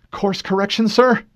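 strained, cracking plea; voice breaking. Acted. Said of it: "voice broke with strain".

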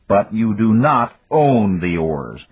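slow, every word weighted; firm insistence. A narrator's reading. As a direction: hammer it home slowly. Dan insists on the law.

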